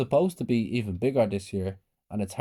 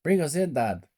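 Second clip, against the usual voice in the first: high, eager plea, lightly raised; children shouting; 6 semitones higher than usual. A narrator's reading. Children beg their dad to take them in, mild shout. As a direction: pester dad like an excited child. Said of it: "my brothers and sisters shouted".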